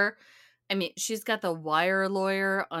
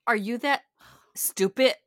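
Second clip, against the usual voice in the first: louder Jersey mom, hard T ending "stupid"; mom's Jersey accent; 5 semitones higher, 6 dB louder than usual. Retold or imitated. Mom scolding her daughter, in a Jersey accent. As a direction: punch it in a mock Jersey accent. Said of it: "quote mom in her full realness".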